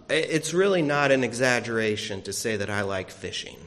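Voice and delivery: ordinary talk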